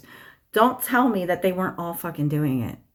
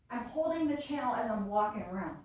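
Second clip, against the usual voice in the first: "I'm holding the channel" said from across the room, faint and distant; far from the mic; 2 semitones higher, 11 dB quieter than usual. Shown as a demonstration. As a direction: keep talking while out of range of the mic. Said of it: "demonstrating doing such".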